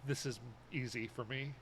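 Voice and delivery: ordinary talk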